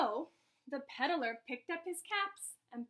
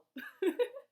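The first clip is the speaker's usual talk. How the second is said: brief, high, real giggle; short laugh; 6 semitones higher, 4 dB louder than usual. Real, not acted. Reader balancing caps on the head, high giggle. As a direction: giggle lightly in short bursts.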